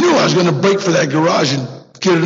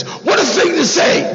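incredulous outburst; 10 semitones higher than usual. Real, not acted. Outraged at parole board.

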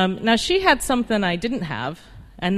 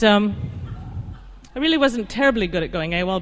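usual speaking voice

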